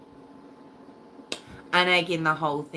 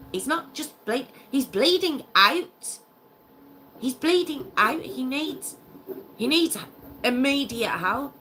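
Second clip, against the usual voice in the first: outraged disbelief; 8 semitones higher than usual. Real, not acted. Host appalled at the girlfriend's cruelty, high outraged disbelief. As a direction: insist he's dying, voice rising high.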